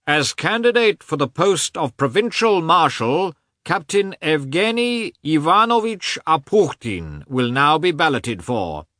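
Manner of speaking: high, loud official calling out names; loud shrill announcement. A narrator's reading. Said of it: "announced in a loud, shrill voice".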